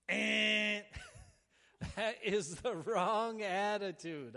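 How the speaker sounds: a sudden loud laugh, then a slow, loud declaration; laughing loudly, then dead serious; emphatic laughing outburst. Real, not acted. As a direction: burst out laughing and hammer the point home. Preacher corrects bad prayer attitude.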